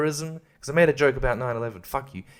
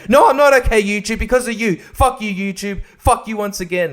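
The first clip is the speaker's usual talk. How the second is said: higher, louder, yelling at YouTube; furious shout; 7 semitones higher, 4 dB louder than usual. Real, not acted. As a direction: let the anger explode at the check-in email. Furious reply to YouTube's email.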